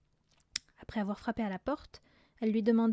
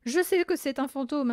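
louder, raised-pitch insistence; insistent; 4 semitones higher, 6 dB louder than usual. A narrator's reading. Mathilda convincing family of a ghost, insistent. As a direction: press the point firmly. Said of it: "insista Mathilda".

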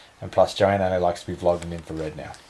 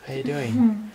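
put-on squeaky high greeting to the baby; 12 semitones higher, slower than usual. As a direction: chirp it way up high.

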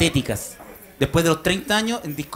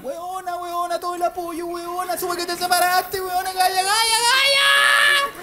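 put-on much higher, squealing girlfriend voice; gushing girlfriends; 12 semitones higher, slower than usual. Friends phoning the newly separated woman, high gushing girlfriend voice. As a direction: gush like an overexcited best friend. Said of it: "todas la llaman".

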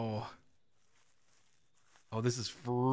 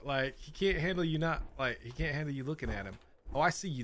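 ordinary talk, nothing marked

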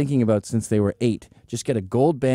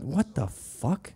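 disbelief; 3 semitones higher, 7 dB quieter, much slower than usual. Retold or imitated. Thought reaction, disbelief.

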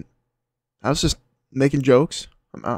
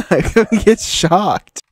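slow laughing line; 7 semitones higher, 4 dB louder, much slower than usual. Real, not acted. Laughing at his own joke.

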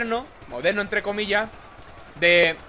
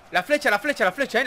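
ordinary talk, nothing marked

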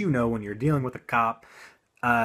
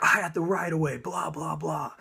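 mock yelling; 7 semitones higher than usual. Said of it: "he starts yelling at me".

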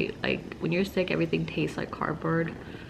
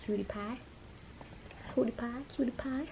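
soft cooing; 6 semitones higher, 10 dB quieter than usual. Real, not acted. Cooing to baby.

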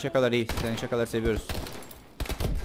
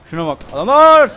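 excited shout; 9 semitones higher, 15 dB louder, much slower than usual. Real, not acted. Excited loud exclamation.

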